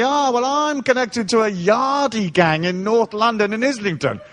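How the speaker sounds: pompous bragger mocked by the teller; mocking the club owner. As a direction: lampoon a tough guy's brag. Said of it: "he says to me".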